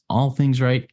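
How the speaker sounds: "All" pitched high and stressed; emphatic ALL. Shown as a demonstration. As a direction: lift "All" well above the rest. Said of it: "things that I emphasize as I do this".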